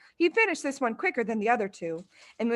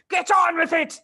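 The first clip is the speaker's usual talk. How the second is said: loud, higher impatient order; angry shout; 8 semitones higher, 6 dB louder than usual. A narrator's reading. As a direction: bark at him. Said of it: "she shouted".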